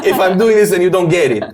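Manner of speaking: hammed-up Italian-accented English. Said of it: "It's an Italian".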